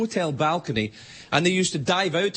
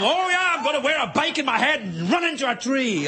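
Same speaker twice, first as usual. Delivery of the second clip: high-pitched mock surf dude; hammed-up extreme-sports dude, voice way up; 8 semitones higher than usual. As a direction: play a gung-ho dude boasting about a stunt. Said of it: "the Galveston surf dude".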